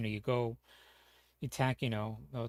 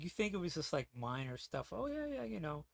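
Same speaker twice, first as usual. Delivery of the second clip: high, mocking "oh yeah, yeah" in a much higher voice; sarcastic lilt, pitched way up; 6 semitones higher than usual. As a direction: scoff at the idea it was minor stuff. Mocking the idea it was minor.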